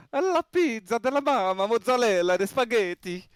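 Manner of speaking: high, singsong Italian caricature; drawn-out mock Italian; Italian accent. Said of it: "l'accent italien".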